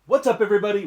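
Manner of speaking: a big, loud opening Boom; excited shout. Real, not acted. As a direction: shout it out to kick off the video. Channel intro shout.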